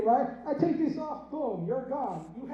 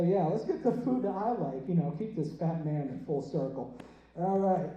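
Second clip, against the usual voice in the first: normal talking voice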